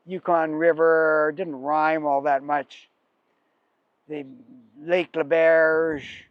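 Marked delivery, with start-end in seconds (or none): 4.8-6.2 "Lebarge" said as luh-BAIRZH, drawn out slowly; original pronunciation. Shown as a demonstration. Storyteller trying out the lake's name for a rhyme, "Lebarge" as luh-BAIRZH. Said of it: "that quickly became the marge".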